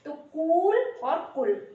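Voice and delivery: कूल long and कुल short, said slowly; slow contrast. Shown as a demonstration. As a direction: draw out कूल then clip कुल. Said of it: "छोटे ऊ और बड़े ऊ की मात्रा का अंतर".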